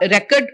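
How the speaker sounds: REcord with front stress; record as noun. Shown as a demonstration. Trainer giving a noun example, REcord stressed first. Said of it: "if it is a noun".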